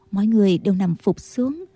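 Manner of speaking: usual speaking voice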